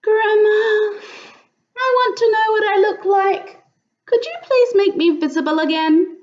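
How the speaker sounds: a hint of small girl's voice; little possum voice. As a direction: ask Grandma like a hopeful child. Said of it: "Hush said".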